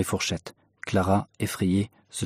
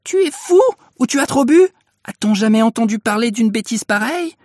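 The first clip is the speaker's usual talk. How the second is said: high, loud tirade; shrieking scolding wife; furious outburst; 13 semitones higher, 6 dB louder than usual. A narrator's reading. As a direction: explode at your husband in indignant questions. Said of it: "la mère Louveau éclata".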